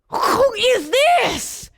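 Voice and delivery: high, slow, drawn-out astonishment; amazed crowd. Acted. Synagogue crowd marvels at Jesus' power, amazed slow question. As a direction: stretch it out high in wonder.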